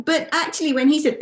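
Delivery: normal talking voice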